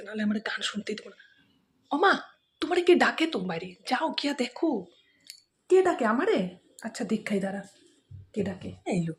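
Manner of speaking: ordinary talk, nothing marked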